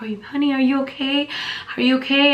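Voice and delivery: high, worried stranger's voice; a shopper checking on a fainting pregnant woman; concerned lady. Retold or imitated. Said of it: "She's like".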